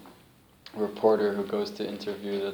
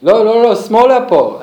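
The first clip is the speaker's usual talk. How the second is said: high, loud mock shout of Lo, lo, lo; calling out to the driver; 13 semitones higher, 17 dB louder than usual. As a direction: passengers yelling at the bus driver to turn, much higher and louder. Passengers calling out to bus driver.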